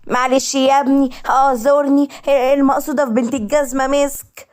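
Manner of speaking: flustered apology. Acted. Mother apologizing after slipper hits.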